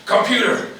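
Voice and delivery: loud Irish American general; Irish American accent. A narrator's reading. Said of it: "uh, Irish American".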